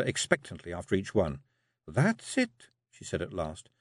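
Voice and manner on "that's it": high Scots rising approval; Jeanie approving. Acted. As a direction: she nods him through.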